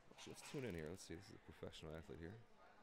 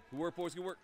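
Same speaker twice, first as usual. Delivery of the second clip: much higher, loud praise call; cheering shout; 12 semitones higher, 12 dB louder than usual. Real, not acted. Fan cheering the players after a goal, loud. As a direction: shout praise across the rink.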